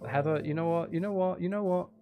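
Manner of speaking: ordinary talk, nothing marked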